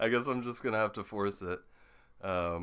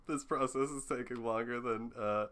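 ordinary talk

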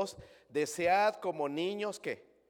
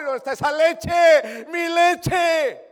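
put-on high, loud, slow wail of a hungry baby; screaming baby; 12 semitones higher, 15 dB louder, much slower than usual. Pastor mimics baby [name] crying for milk, high screaming wail. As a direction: shriek it like a newborn demanding milk at night. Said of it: "Se pone a gritar".

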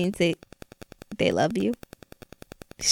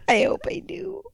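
unvoiced laughing whisper; whispered giggle; 7 semitones higher, 9 dB quieter than usual. Real, not acted. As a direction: breathe it out through a laugh.

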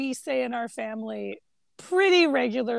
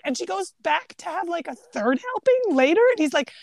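usual speaking voice

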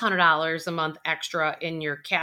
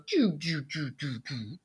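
sinking doo sound; 5 semitones lower, 6 dB quieter than usual. Retold or imitated. Energy sinking imitated.

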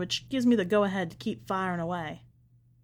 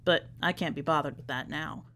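ordinary delivery, nothing marked

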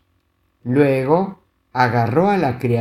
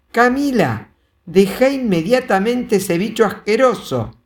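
stern mother; 8 semitones higher than usual. A narrator's reading. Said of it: "Era una voz de mujer".

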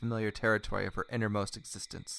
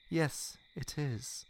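slow, higher character reply; slow confirmation; 6 semitones higher, much slower than usual. Acted. He confirms her guess, slow and drawn-out. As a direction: draw the answer out.

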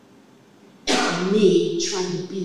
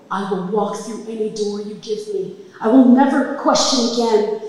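desperate prayer; 6 semitones higher, 5 dB louder than usual. Retold or imitated. Praying to God for her life.

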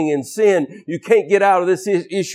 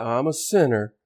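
low, soft token confession; vague, generalized admission; 6 semitones lower, 6 dB quieter than usual. Acted. Proud churchgoer generalizing his sins, soft noncommittal admission. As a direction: admit it softly, committing to nothing. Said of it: "we want to generalize".

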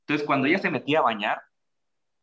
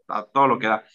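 ordinary delivery

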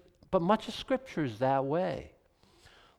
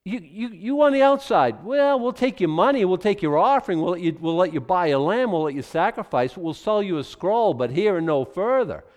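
dismissive temple officials; 5 semitones higher, 8 dB louder than usual. Retold or imitated. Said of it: "they said".

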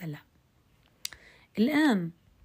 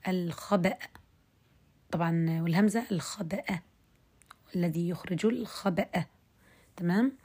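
ordinary talk, nothing marked

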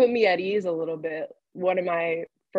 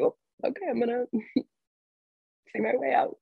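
sheepish laughing; 6 semitones higher than usual. Retold or imitated. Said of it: "I was like".

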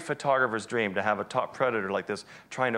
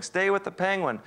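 "Stay with the penguin" called out much higher; mock yell; 8 semitones higher than usual. Guide shouting from the boat to the photographer in the water, mock yell. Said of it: "He's yelling".

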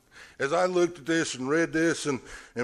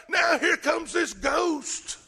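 terrified shout; 4 dB louder, slower than usual. Acted. Acted panic continues.

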